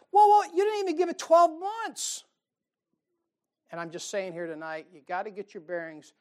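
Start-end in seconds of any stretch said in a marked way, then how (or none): 0.1-2.2 high, loud, incredulous put-on voice; hammed-up startled business type; mock panicked businessman. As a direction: throw up your hands in mock alarm. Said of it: "and they're like".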